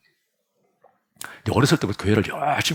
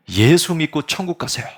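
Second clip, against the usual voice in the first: loud, higher preacher's cry; young preacher shouting to the congregation from the pulpit; 7 semitones higher, 4 dB louder than usual. Acted. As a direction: call it out to the whole congregation. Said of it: "이렇게 소리를 지르는 거야".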